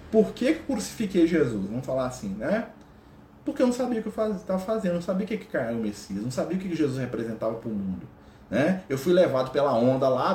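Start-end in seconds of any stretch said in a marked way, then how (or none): none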